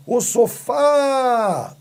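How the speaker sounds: high, drawn-out FÁ in "sofá"; stretched calling out. Shown as a demonstration. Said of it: "quando você fala alto, quando você estica".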